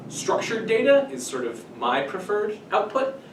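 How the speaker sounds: ordinary talk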